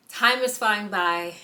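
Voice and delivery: short of breath, slow; breathless, pregnant and winded. Real, not acted. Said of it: "I'm out of breath already".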